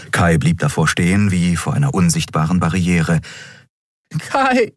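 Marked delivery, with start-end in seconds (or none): 4.2-4.7 high, imploring cry; desperate high plea; pleading. A narrator's reading. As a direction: beg him by name. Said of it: "flehte Andriel".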